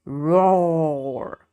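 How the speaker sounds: a hammed-up roar; mild dinosaur roar. A narrator's reading. Sid roaring to scare other dinosaurs, playful roar. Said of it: "he had the loudest roar of all".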